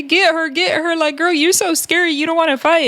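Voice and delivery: high mocking imitation; mocking mimicry. [name] mimicking Erica Raven calling security, high mocking taunt. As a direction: parrot her back mockingly.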